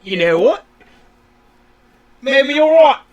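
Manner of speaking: badly faked British-Australian accent; muddled mock British-Australian voice; vague British-Australian accent. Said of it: "British or Australian".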